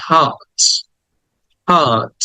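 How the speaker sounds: "hot" with a long aa, silent r; drawn-out vowel. Shown as a demonstration. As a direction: stretch the vowel in "hot", haat. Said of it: "আটাকে টেনে বলতে হবে".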